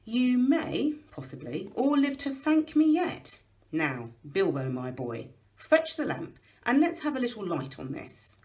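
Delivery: a hint of an old wizard, firm and kindly; lightly voiced Gandalf. Wizard reassuring the dwarves, lightly voiced old wizard. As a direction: speak as the wise old wizard, only lightly shaded.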